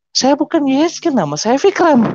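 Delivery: high-pitched retold protest; bewildered protest. Young man correcting a mistaken name, high bewildered protest. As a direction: insist on the name, pitched well up.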